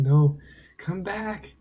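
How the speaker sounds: slow, loud, high call after it; pleading with the butterfly to come back, slow and loud; drawn-out plea. Real, not acted. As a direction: stretch it out, begging it to return.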